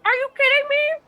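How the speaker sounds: high, loud disbelief; can't believe it, exasperated; incredulous outburst. Real, not acted. Player learns the gem was taken.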